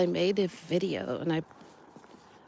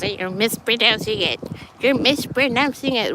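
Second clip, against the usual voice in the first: mock nagging correction; 4 semitones higher, 4 dB louder than usual. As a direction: imitate fussy locals, louder and higher on the repeat. Acting out Norwegians correcting her pronunciation.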